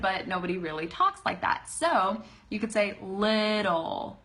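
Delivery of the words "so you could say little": little with a quick D in the middle; flapped T in little. Shown as a demonstration. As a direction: say little as lih-dle, slow. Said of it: "It's a D sound in the middle".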